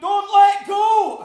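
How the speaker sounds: screaming as he grips the big man's hand. Acted. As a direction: scream it with everything left. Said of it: "I scream as I hold".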